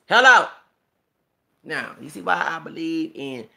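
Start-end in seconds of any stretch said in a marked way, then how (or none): 0.1-0.5 very loud, high exclamation; emphatic hello. Real, not acted. Driving home a point to viewers, loud high hello. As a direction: belt it out like a punchline.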